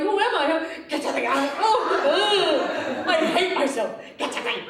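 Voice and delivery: high, loud laughing self-disgust; mock exasperated meltdown; laughing at herself. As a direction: cringe at yourself, laughing. Reliving losing it, self-disgust.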